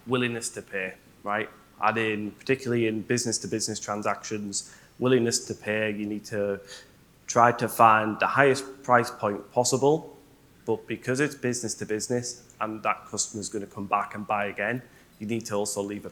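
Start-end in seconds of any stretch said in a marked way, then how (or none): none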